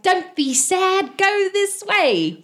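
high, slowly drawn-out coaxing voice; smiling cheer-up. Acted. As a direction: coax someone sad along with a smile. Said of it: "he's smiling 'cause he's trying".

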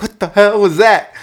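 jolted right after the near miss; shocked. Retold or imitated. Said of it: "And I'm like".

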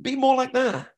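eager underdog voice. Retold or imitated. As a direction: plead like a keen rookie. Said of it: "That's Louis, isn't it?".

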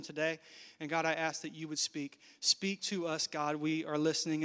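soft, low pastoral prayer; hushed, reverent prayer voice; quiet prayer. Acted. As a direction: pray softly and intimately to God. Leads the congregation in prayer.

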